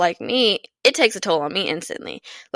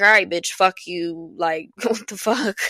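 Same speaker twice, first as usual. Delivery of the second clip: rough, dismissive retort that ends in a laugh; fed-up comeback. Retold or imitated. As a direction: throw back "All right, bitch, fuck you" then laugh at it.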